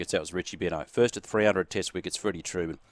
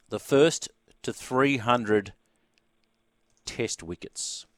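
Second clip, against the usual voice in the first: slow quiz reveal; much slower than usual. Acted. Quiz host poses question.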